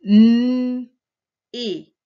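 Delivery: "M" as a loud, long hummed mmm, then a short "et"; sounding out. Shown as a demonstration. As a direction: hold "M" as a strong hum, pause, say "et". Teacher sounds out a syllable.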